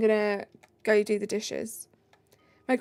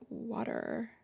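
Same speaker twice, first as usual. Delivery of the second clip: put-on American accent; 11 dB quieter than usual. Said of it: "like Millie Bobby Brown's American accent".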